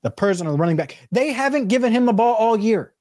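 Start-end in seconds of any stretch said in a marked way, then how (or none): 1.1-2.9 high, exasperated rant; frustrated. Real, not acted. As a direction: vent at the bad call. Frustrated rant about play call.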